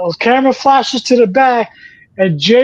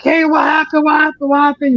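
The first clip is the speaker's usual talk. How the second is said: higher, urgent mock yell repeating the question; retold yelling; 6 semitones higher than usual. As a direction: call out to everyone backstage, pressed and anxious. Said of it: "She's yelling at everybody".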